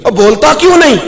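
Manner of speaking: high, loud angry demand; angry uncle. Retold or imitated. Uncle angrily confronts nephew who wants to leave, loud and high. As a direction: bark it at the nephew from above.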